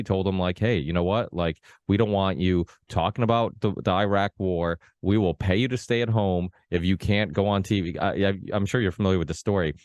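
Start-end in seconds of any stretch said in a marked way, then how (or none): none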